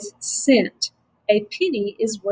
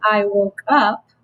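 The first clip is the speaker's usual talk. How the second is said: "I woke up" as one slow, connected group; one phrase; slower than usual. Shown as a demonstration. Teacher showing a phrase, "I woke up" slow and joined. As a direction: say "I woke up" slowly, set apart as a unit. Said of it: "is a phrase".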